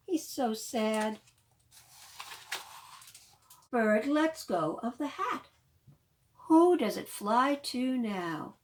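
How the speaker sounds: loud, lively storytime reading; reading to children. Acted. Librarian reading The Rainbow Hat aloud to children, lively. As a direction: bring the picture book alive for little ones. Reads picture book to children.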